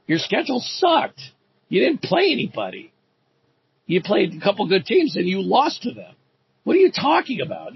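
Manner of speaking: a high, exasperated comeback to the boasting coach; heated retort. Retold or imitated. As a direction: fire back at him in disbelief. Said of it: "And I'm like".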